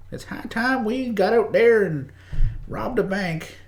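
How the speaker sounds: put-on high, slow cowboy drawl from old westerns; western accent. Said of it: "Did everybody like my western accent".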